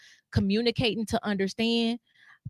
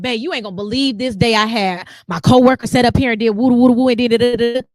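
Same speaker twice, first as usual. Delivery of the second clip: gushing about a bad day; breathless mock vent to her man; excited rant; 3 semitones higher, 9 dB louder than usual. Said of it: "I'm like".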